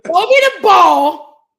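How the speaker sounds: loud, slow call for the ball; loud stretched call to the quarterback. Pretend. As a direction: shout it across the field. Calling out to quarterback Mac.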